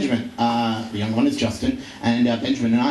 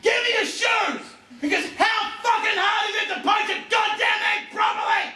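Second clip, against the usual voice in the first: screaming back. Retold or imitated. Ben yelling back at Justin while throwing clothes out the window, high mock yell. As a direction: bellow it like a furious brother in a viral clip. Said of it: "he was screaming back".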